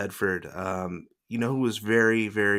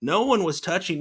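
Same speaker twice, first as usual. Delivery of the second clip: normal talking voice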